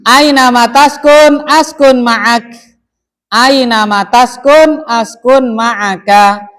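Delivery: slow booming classroom chant; loud recitation. Shown as a demonstration. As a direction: proclaim it to the whole class, drawn out. Teacher declaims the Arabic example.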